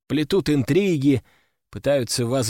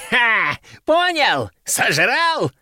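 high, crowing taunt; gloating laughter; 8 semitones higher, 3 dB louder, much slower than usual. A narrator's reading. Old collector mocks the beaten rival, high gloating. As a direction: crow over the loser. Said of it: "расхохотался он".

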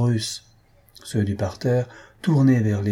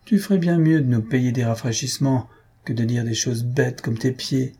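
plain reading voice

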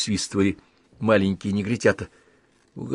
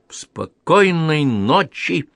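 slow polite bow greeting; 8 semitones higher, 4 dB louder, much slower than usual. Acted. Hubert bows, slow greeting.

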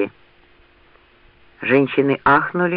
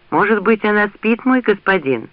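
higher-pitched, tentative question; tentative servant; 7 semitones higher than usual. A narrator's reading. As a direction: timidly offer a hopeful suggestion to your master. Another character gently suggests to Rand.

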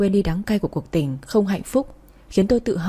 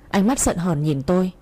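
plain reading voice

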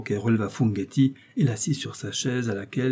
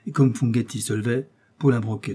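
ordinary delivery, nothing marked

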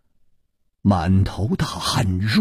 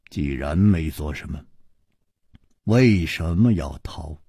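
calm low questioning; 10 semitones lower, 3 dB quieter than usual. A narrator's reading. Chu Liuxiang questions calmly.

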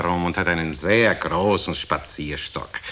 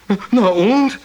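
impatient prodding; 11 semitones higher, 6 dB louder than usual. Acted. Listener growing more impatient.

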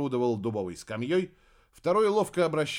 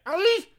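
high yelp; 8 dB louder than usual. A narrator's reading. Ruler falls from shoulder.

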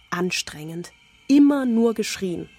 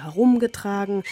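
usual speaking voice

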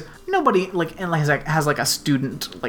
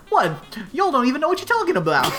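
fake Southern accent; 7 semitones higher, much faster than usual. Mocking the kid's sudden Southern, fast laughing mock Southern. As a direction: throw in a hammy Southern accent. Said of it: "they'll just throw in Southern".

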